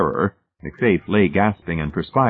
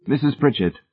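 casual, higher-pitched address; 7 semitones higher than usual. A narrator's reading. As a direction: open the topic casually. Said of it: "Hamilton said casually".

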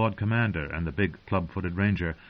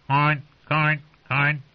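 raucous raven =33; 5 semitones higher, slower than usual. Acted. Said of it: "the bird's raucous calls".

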